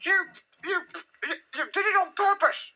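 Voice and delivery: very high, stammered accusation; spluttering. A narrator's reading. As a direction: splutter through soapy water in a squeaky voice. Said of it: "spluttered Piglet".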